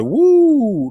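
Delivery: high swooping woo. Retold or imitated. Host voices a viewer's typed cheer, high woo. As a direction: let out a whoop that soars up. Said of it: "Lucy Lou said".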